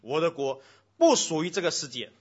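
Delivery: booming sermon reading; preaching at full force. Acted. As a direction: proclaim it to the whole hall. Reads Jesus' words with force.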